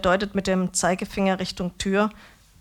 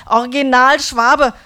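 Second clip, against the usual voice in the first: loud, higher, chest-thumping boast; proud declaration; 5 semitones higher, 11 dB louder than usual. A narrator's reading. Döner man proudly declaring to the narrator, loud. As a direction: proclaim it like a battle cry.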